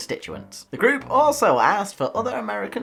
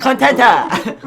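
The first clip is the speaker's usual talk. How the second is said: loud, high mock bellow with laughter; big silly outburst; mock shout; 5 semitones higher, 12 dB louder, slower than usual. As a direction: holler it like a hammed-up catchphrase. Repeats word, quiet then shouted.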